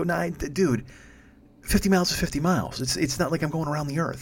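lightly acted weary driver; exasperated reassurance. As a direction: talk down a worrier with mild exasperation. Retold driver soothing a fretting rider.

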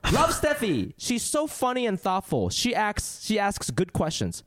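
sarcastic read of a hater's comment; mock commenter voice. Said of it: "I'll read you what he said".

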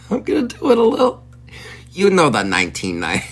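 high-pitched giggling through words; can barely talk for laughing. Real, not acted. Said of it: "I'm choking on my own laughter".

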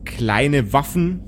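ordinary talk

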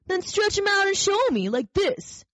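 wild rabbit showing off; daring wild rabbit. Acted. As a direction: dare him to show you.

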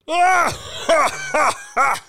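loud booming tyrant laugh; roaring laugh. A narrator's reading. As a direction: bellow out a cruel laugh. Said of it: "roared the latter".

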